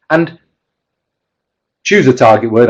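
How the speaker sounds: normal talking voice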